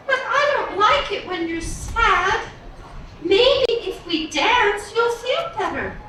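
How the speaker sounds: high, sweet princess voice. Acted. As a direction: coax her sad father, high and gentle. Princess comforts father.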